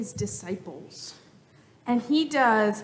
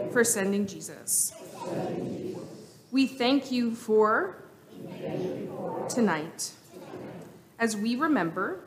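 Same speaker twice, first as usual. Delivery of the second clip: prayer; 2 semitones lower, 5 dB quieter than usual. Acted. Leads children in prayer.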